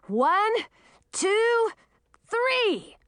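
high, rising count-off; urgent count. A narrator's reading. Man counting before lifting his mother, urgently calling. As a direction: call out the count for a heave.

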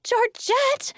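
high, loud shout of a name; scolding high-pitched yell; accusing yell. A narrator's reading. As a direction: yell it in outrage. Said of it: "Harper yelled".

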